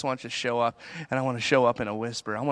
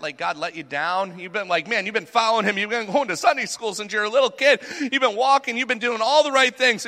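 rising higher and louder with each line; building frustration; 9 semitones higher, 4 dB louder than usual. Acted. Preacher voicing a believer's frustration, rising higher and louder. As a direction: build the complaint to a passionate peak.

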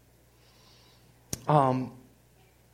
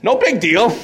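breezy confidence; 8 semitones higher, 8 dB louder than usual. Retold or imitated. Mocking his own bold confidence.

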